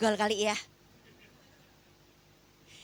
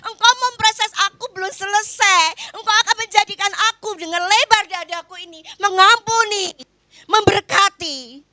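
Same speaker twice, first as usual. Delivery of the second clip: high, loud cry of thanks to God, slowing on the last word; re-enacting her prayer of thanks aloud, fervent; thankful prayer; 8 semitones higher, 8 dB louder than usual. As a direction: pour it out to God with fervent gratitude. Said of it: "Saya bersyukur".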